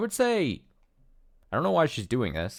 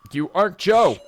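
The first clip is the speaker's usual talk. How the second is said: high, very loud cry of denial; acted distraught outburst; desperate shout; 11 semitones higher, 11 dB louder than usual. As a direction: yell it, pitch and volume leaping up. Sarah repeats the denial, shouting.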